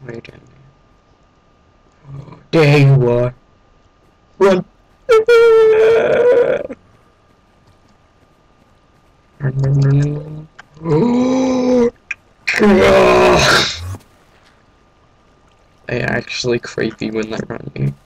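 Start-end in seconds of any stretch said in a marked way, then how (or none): 2.5-11.9 panicked run, run cries; frantic, fleeing in alarm; being chased. Real, not acted. 12.5-14.0 loud breathless gasp; sharp frightened gasp. Real, not acted. Gasp while fleeing.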